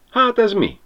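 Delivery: high, astonished cry; exclaiming. A narrator's reading. Berti shows Ribiszke the bottle, exclaiming. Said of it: "kiáltott".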